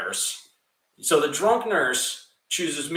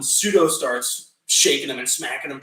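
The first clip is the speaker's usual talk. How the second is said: ordinary talk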